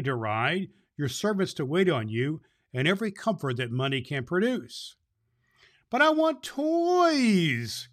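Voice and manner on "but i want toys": put-on high, slow, tearful child's plea; a pouting girl begging for toys, high and slow; crying little girl. A narrator's reading. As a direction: whine it like a spoiled girl near tears. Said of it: "cried Bessie".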